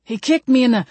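protesting. A narrator's reading. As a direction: protest like the wronged party. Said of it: "Gabriel protested".

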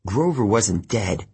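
usual speaking voice

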